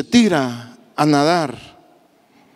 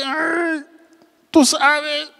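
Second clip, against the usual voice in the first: pained sigh; 9 semitones higher, much slower than usual. Acted. Peter's pained answer voiced.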